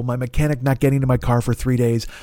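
normal talking voice

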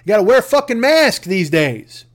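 normal talking voice

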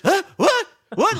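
high, loud startled what; jolted out of a reverie; startled huh. Retold or imitated. Billy interrupted mid-speech, high and startled. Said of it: "And he goes".

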